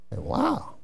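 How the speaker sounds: ordinary delivery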